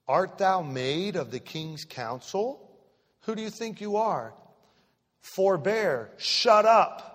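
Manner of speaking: a furious king silencing the prophet; angry king. Acted. King Amaziah shouting down the man of God, enraged.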